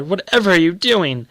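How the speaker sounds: alarmed cry. A narrator's reading. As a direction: call out to her son in alarm. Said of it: "she cried".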